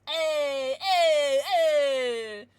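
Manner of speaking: loud, rising hey hey hey; excited calling out. Real, not acted. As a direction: call out in real delight. Excited at a pulled card.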